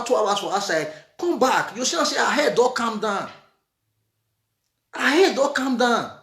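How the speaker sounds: usual speaking voice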